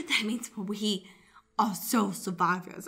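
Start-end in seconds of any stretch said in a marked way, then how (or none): none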